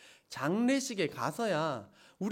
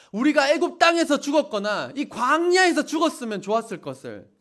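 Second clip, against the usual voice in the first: mock wailing complaint; 5 semitones higher, 6 dB louder than usual. Congregation wails their complaint.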